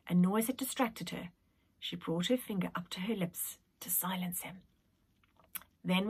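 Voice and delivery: hushed suspense narration; whispering. A narrator's reading. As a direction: whisper it like a secret.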